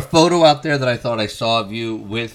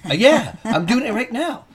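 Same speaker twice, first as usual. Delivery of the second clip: high-pitched in-character reply; 9 semitones higher than usual. Acted. Interviewer mimicking the comic already in character, high-pitched voice. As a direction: play the eager bandmate already in character. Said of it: "you were already grabbing that character".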